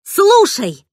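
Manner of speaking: angry yell at a sulking friend. Acted. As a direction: bellow to get his attention. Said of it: "заорала я".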